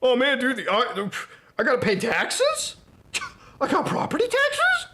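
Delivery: high, whiny put-on voice of a clueless guy; mock dumb dude. Streamer imitating a YouTuber shocked by property taxes, high mock whine. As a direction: squeak out the question like a bewildered bro.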